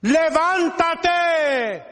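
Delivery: ordinary talk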